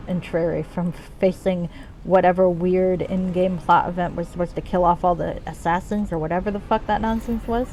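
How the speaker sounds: ordinary delivery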